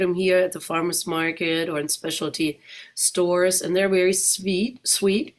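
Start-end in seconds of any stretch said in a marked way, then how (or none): none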